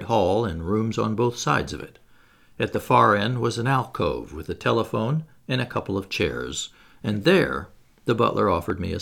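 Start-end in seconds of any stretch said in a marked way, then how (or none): none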